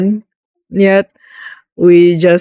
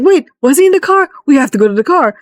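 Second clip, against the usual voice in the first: urgent alarm; 8 semitones higher, much faster than usual. Retold or imitated. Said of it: "It's like".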